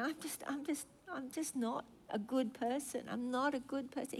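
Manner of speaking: soft, broken mock sob of "not a good person"; imitating crying. Preacher voicing a weeping man at the altar, softly. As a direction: hush it like a grown man in tears. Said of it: "he just started crying".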